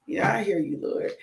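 ordinary delivery

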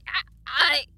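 loud, high frightened stutter; stammering. A narrator's reading. Frozen blacksmith before the creature, stammering. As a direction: choke on the first word in fear. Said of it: "he stammered".

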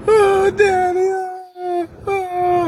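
slow, very high mock wail; hammed-up wordless kid wailing; imitated crying child. Boy crying to Daddy after losing, imitated wail. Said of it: "He cried".

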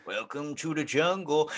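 slow, stretched rock singer voice; sing-speak it like a rock frontman. Retold or imitated. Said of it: "That's an A C, you know, A C D C?".

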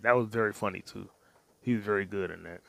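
normal talking voice

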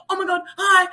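high, flustered greeting; startled. Retold or imitated. Caught clowning by a neighbour, startled high greeting.

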